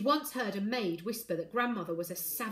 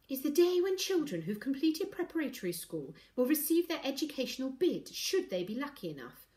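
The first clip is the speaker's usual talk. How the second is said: higher-pitched, grand explanation; self-important; 7 semitones higher than usual. A narrator's reading. Ivy explains bid day to the family, self-important. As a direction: lecture as if delighted to know best. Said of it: "drawing herself up importantly".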